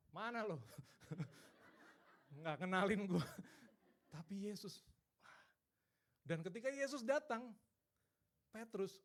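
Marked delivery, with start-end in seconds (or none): 0.1-3.2 loud, accusing challenge played for laughs; mock confrontation. Preacher jokingly confronting Peter in Jesus' place, loud mock accusation. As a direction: pretend to tell off a disloyal friend, loudly.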